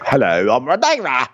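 exaggerated, broader mock British accent; adult Rhaenyra's accent. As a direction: imitate a grown British woman, vowels shifted wide. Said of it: "Your accent just changed regions".

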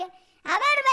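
a mock grandma scolding her son; regaño de abuela. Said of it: "bien roja mi abuelita de puro coraje". (llamado enojado de abuela)

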